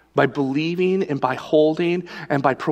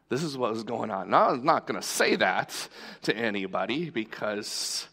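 ordinary talk

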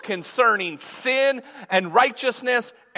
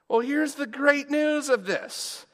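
usual speaking voice

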